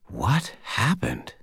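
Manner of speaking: slow, soft whisper. Acted. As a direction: breathe the question out. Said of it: "Butters whispered".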